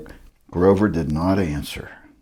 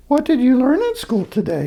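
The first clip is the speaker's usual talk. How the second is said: much higher, sing-song mother; pitched-up mother asking; mother's voice. Acted. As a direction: ask it like a fond mom. Mother's voice retakes the question.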